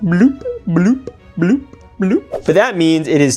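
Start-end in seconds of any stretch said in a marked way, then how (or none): none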